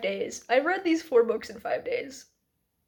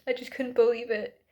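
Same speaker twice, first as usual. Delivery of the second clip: ordinary talk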